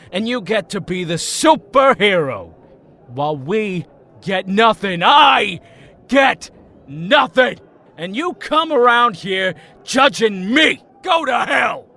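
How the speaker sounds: rising into an angry yell; a jealous friend boiling over; bitter shout. Acted. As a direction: hammer each word in resentment. Tommy's bitter rant at Jason.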